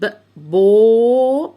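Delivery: short ب, then "بو" held long and loud; loud, sustained "بو" after a clipped ب; sounding out. Shown as a demonstration. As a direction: stretch "بو" out very slowly for repeating. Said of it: "قول معايا".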